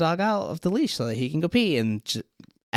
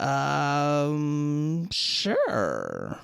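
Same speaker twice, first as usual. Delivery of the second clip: mock indifference. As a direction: drag out a reluctant, noncommittal agreement. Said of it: "the tone of indifference".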